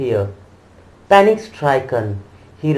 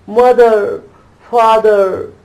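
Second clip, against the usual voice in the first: high, loud tearful calling out; sobbing call; 7 semitones higher, 6 dB louder, slower than usual. A narrator's reading. Lost child in the crowd calling his parents, tearful cry. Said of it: "his little sob lingering".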